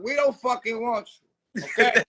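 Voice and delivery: ordinary talk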